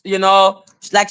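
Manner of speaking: normal talking voice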